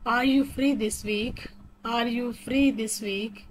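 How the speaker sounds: very slow, words spaced out evenly; slow robotic reading. Shown as a demonstration. Teacher showing how to interrupt politely, slow and robotic. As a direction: lay out each word for learners. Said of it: "थोड़ा robotic वे में पढ़ के बता रही हूँ".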